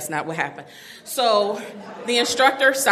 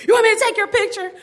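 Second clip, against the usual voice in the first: high, fast, louder mock voice of the little instructor; mimicking the instructor; 7 dB louder, faster than usual. Little instructor offering to take her picture, high quick mock voice. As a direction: pipe it up quick and bright like a chirpy young instructor.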